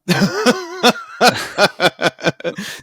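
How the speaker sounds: long, high-pitched real laughing fit; helpless laughter. Real, not acted. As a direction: crack up at a cheeky jibe. Joke about his mum sets laughter off.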